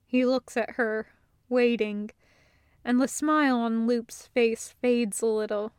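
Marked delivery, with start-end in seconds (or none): none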